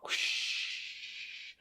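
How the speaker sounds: long, airy rushing whoosh. Acted. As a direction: draw out a voiceless rush of air. Imitates the sleigh flying off.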